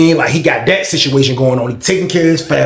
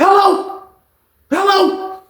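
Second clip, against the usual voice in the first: frantic hello; much slower than usual. Retold or imitated. Line went dead.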